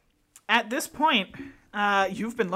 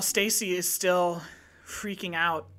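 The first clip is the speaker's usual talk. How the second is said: usual speaking voice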